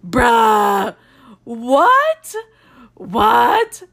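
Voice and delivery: loud, shouted real disbelief; outraged disbelief. Real, not acted. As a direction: yell it at the TV in shock. Outraged reaction to the show.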